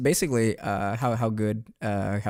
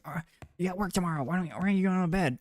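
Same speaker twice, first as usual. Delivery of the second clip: higher, fast nagging mom voice; imitating scolding mom; 7 semitones higher, faster than usual. Streamer mimicking his mom telling him to go to bed, fast higher nagging. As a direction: rattle off the scolding like an exasperated mom. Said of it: "She's gonna yell at me. She's like".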